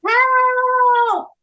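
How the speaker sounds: very high, drawn-out yell; full-force shout. A narrator's reading. Snow Princess shouting into the wind for help, full-force shout. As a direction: shout it with all your might. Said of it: "with all her might and shouted".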